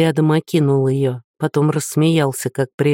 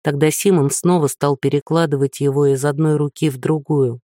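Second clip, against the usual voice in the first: usual speaking voice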